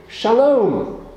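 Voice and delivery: "Shalom" said high and bright with a long falling "lom"; Hebrew greeting. Shown as a demonstration. Preacher teaching children a Hebrew greeting, high and bright. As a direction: give them a bright, drawn-out "Shalom". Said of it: "You'd say".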